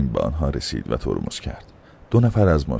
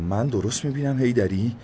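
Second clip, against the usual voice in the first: higher, hushed disbelief; can't believe his eyes; a touch of shocked inspector; stunned disbelief; 9 semitones higher than usual. A narrator's reading. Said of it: "آب دهانش را قورت داد و گفت".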